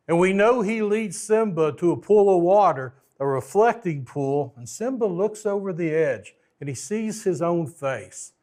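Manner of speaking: ordinary delivery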